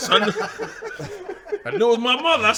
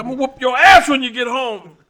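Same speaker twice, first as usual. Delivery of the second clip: mother's threat; 6 semitones higher, 6 dB louder than usual. Retold or imitated. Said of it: "She said".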